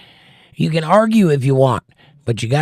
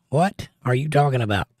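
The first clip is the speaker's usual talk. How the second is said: ordinary talk, nothing marked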